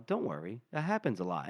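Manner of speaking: low, soft, soothing voice; calm a scared child gently; fatherly reassurance. Acted. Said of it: "talking like a father to a scared child".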